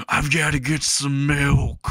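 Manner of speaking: lightly hammed-up dad making his escape; fleeing dad. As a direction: stretch it out as you flee. Dad yells while fleeing cop.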